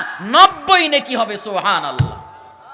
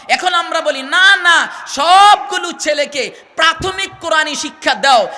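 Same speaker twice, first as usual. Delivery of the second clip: forceful exhortation; 4 semitones higher, 6 dB louder than usual. Real, not acted. Preacher's emphatic exhortation.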